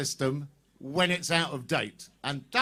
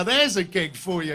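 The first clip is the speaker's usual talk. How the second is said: ordinary talk, nothing marked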